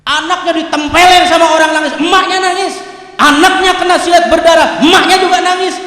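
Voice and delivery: high, impassioned sermon delivery; preaching at full force. Acted. Preacher extolling parents' sacrifice for children, forceful high preaching. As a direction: build each example into a forceful cry.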